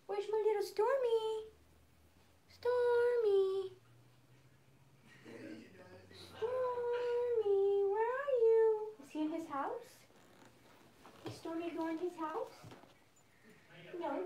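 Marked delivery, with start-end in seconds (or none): 0.0-8.9 high, sweet pet-talk call; calling the cat. Real, not acted.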